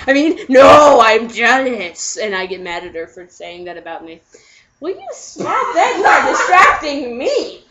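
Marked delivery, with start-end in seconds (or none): 0.1-2.2 loud, slow correction; flustered backpedal. Retold or imitated. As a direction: catch yourself loudly. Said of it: "And he goes".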